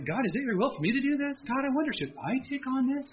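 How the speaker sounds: high, quick worried pleading to God; mock the hesitant believer; whiny fretting prayer. Preacher mocking a fretful believer's prayer, high and whiny.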